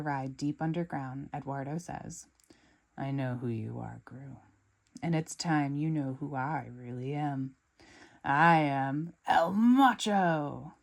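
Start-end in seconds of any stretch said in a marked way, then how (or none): 3.0-10.7 menacing villain reveal. Acted.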